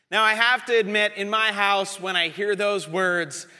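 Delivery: ordinary talk, nothing marked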